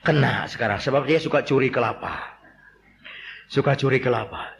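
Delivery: usual speaking voice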